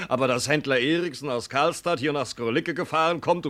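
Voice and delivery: usual speaking voice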